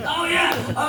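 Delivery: high shouted oh yeah; sudden recall. Real, not acted. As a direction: snap back as you remember. Suddenly remembers question.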